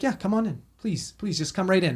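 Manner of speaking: changed, welcoming tone. Retold or imitated. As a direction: usher them in, voice lifted. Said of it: "tone completely changed".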